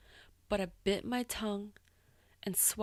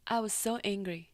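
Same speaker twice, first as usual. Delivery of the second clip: usual speaking voice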